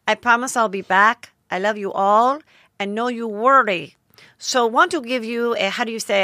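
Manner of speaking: mock Spanish accent, carefully enunciated; mocking Spanish-accented sign-off to followers; Hilaria Baldwin. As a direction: read the post in a put-on Spanish accent. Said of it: "I have to do the accent".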